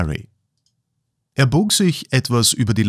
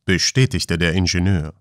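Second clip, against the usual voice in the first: usual speaking voice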